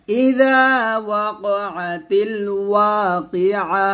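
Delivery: slow melodic الواقعة, drawn out; recite for repetition. Shown as a demonstration. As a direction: model it for students to repeat. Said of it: "আবার বলেন". (শিক্ষার্থীদের জন্য ধীরে তিলাওয়াত)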